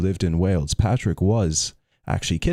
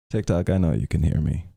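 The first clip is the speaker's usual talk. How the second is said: plain reading voice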